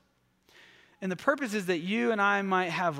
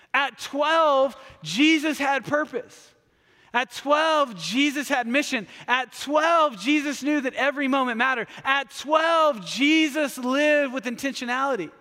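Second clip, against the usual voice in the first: preaching refrain; 4 semitones higher than usual. Acted. Pastor driving home his point to the congregation, building fervor. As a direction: build the repeated line like a sermon climax.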